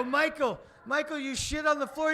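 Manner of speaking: high, loud call; loud, high shout across the house; shouting after him. Retold or imitated. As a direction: yell after a kid running upstairs. Calling after the fleeing kid.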